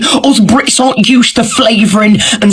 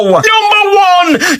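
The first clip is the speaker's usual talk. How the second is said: drawn-out, higher, cry-like announcement of Number one; dramatic countdown call; 5 semitones higher, slower than usual. Pretend. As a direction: hype up the number one slowly.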